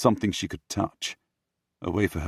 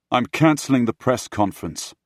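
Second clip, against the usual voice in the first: loud, raised, forceful statement; defiant announcement; 6 semitones higher, 7 dB louder than usual. Acted. Prince bursting in on the Queen, defiant announcement. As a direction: declare it firmly, no room for argument.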